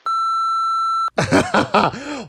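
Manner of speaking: squealing high laugh; delighted squeal of laughter. Real, not acted. Host laughs at caller.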